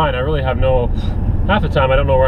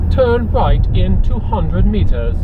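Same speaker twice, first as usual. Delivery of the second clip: slow, higher robotic British sat-nav voice; mock navigation voice, slow and higher; GPS voice; 5 semitones higher, slower than usual. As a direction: imitate the sat-nav announcing a turn. Quoting the GPS voice.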